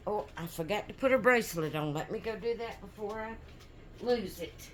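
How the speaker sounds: ordinary delivery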